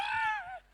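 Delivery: sharp, very high scream of fright; a sudden shriek as she is caught; startled scream. Retold or imitated.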